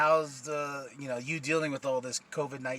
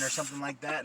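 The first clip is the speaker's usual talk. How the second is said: usual speaking voice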